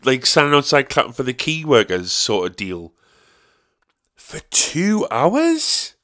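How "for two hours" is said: incredulous. Real, not acted. Incredulous at two hours.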